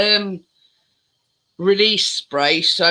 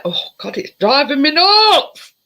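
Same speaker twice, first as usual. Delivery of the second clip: exasperated; 8 semitones higher, 4 dB louder than usual. Real, not acted. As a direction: let the annoyance boil over. Same complaint, now exasperated.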